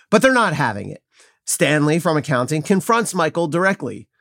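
ordinary talk

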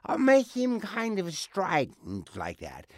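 high, slow, strident blob voice; strident Krang voice. Shown as a demonstration. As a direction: make it cut through, high and slow. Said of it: "Pitch characteristic".

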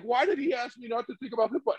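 high, soft mock bafflement; puzzled inner voice. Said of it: "you can't, like".

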